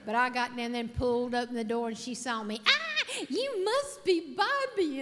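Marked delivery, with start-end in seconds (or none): 2.7-5.0 high, loud, slow gushing welcome; bubbly spa girl. Retold or imitated. Spa girl greeting Bobby at the door, high and loud. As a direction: coo the name like a cheerleader.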